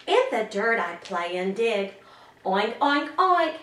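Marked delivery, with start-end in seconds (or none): 2.4-3.6 lightly oinked like a pig, last one slow; a gentle pig snort for the child; mock pig oink. To the child, a pig riddle clue, light mock oinks. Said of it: "animal riddles that you might like to do with me".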